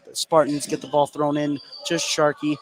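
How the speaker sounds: ordinary delivery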